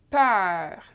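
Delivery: "peur" with open eu and French R; open vowel example. Shown as a demonstration. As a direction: model "peur", vowel wide and held. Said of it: "as in".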